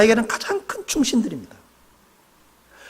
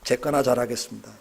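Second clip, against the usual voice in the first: humble confession; 9 semitones lower, 6 dB quieter than usual. Shown as a demonstration. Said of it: "옆에 있는 분을 보시면서".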